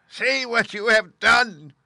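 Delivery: very high, loud, slow shriek of panic; terrified witch scream. Acted. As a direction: scream as she starts to melt. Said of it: "she screamed".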